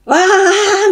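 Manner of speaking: a high, loud, empty "waaa" from the stands; imitating crowd noise that has no content; mock mindless cheering. Mocking noisy fans who just yell "waaa" at a ball game. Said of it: "みたいな".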